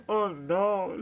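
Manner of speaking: loud, high mock dismay; cried out. A narrator's reading. Brother teasing his blushing sister, loudly crying out. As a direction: shout it out with a big jump in volume. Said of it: "s'écria Zach".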